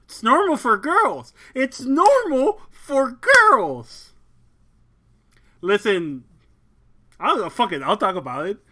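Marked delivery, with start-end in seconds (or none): 0.1-1.3 emphatic. Shown as a demonstration. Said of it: "repeats with emphasis". 1.6-4.1 drawn out and loud; slow emphatic insistence. Shown as a demonstration. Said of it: "repeat slower and louder".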